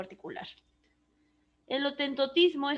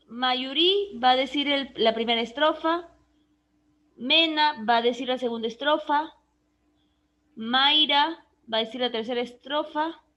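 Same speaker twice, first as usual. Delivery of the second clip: loud roll call; 6 dB louder than usual. Real, not acted. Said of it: "calling on students loudly".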